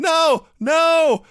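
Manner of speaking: high, loud anguished shout; mock commentator scream of despair. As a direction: scream it as the count comes. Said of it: "Crockett is screaming".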